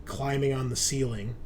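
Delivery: ordinary delivery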